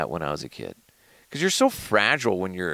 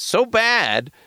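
mock outrage; 14 semitones higher, 4 dB louder than usual.

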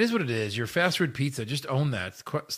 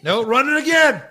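loud high excited shout; 10 semitones higher, 8 dB louder than usual. Real, not acted. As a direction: yell it out in excitement. Excited shout at wrestling clip.

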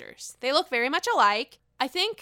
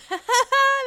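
loud giggle up high; high burst of laughter; 14 semitones higher, 9 dB louder than usual. Real, not acted. Vlogger laughing at own toilet joke, loud and high. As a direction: crack up at yourself.

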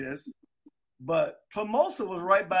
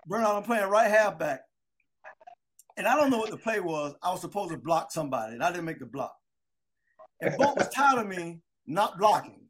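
ordinary talk, nothing marked

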